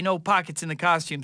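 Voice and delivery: usual speaking voice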